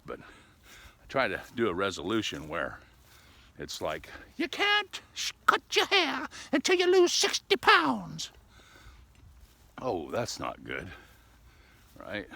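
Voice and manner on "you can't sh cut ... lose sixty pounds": much higher, louder mock voice for a rule; mock stern rule voice. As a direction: lay down a silly rule in a put-on voice. Quoting his own resolution.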